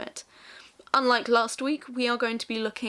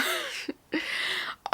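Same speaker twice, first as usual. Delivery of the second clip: high, airy laugh; amused laugh; 5 dB quieter than usual. Real, not acted. Vlogger recalling her overdone audition speech, amused laugh. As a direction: let out a high breathy giggle.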